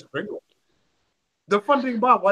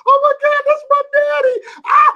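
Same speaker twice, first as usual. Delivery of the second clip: shrieked, much higher and louder squeal of delight; imitating daughter; 11 dB louder than usual. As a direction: mimic a giddy little girl shrieking at her dad. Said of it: "She cried laughing like".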